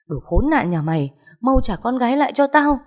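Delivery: angry yell leaping up high; furious yelling. Acted. Mother outside demanding her daughter back, yelling wildly. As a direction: scream it out into the night, pitch climbing. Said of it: "rồi gào lên ầm ĩ".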